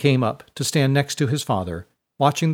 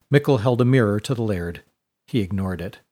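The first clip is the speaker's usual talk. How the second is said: usual speaking voice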